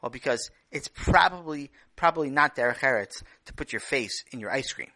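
ordinary delivery, nothing marked

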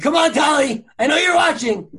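mock shout to the sister at the website; playful call-out. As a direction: yell at the sister watching, half joking. Playfully calling out his sister.

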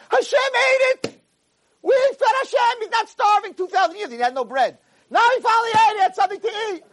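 very high-pitched elated shouting; hammed-up, overjoyed cry; all excited. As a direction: burst in with ecstatic news, voice way up. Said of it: "He's all excited".

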